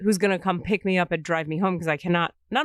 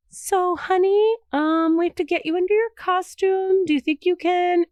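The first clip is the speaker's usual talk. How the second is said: gentle stage manager; 10 semitones higher, 4 dB louder than usual. Retold or imitated. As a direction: sweetly urge her to get into costume. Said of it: "Matt keeps coming in and being like".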